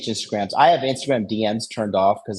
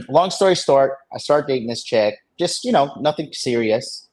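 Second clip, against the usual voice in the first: ordinary talk, nothing marked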